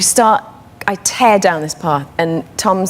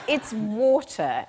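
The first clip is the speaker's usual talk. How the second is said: "water" as British "WAW-tuh"; British accent; 2 semitones higher, 10 dB quieter, much slower than usual. Shown as a demonstration. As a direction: correct it with a drawn-out British "water". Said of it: "Hazel had my accent".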